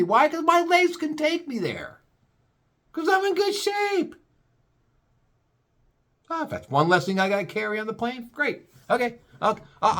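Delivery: high, sing-song sarcastic politeness; mock cheerful compliance. Answering the rude agent, high sarcastic cheer. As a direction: gush sarcastically about checking the bag.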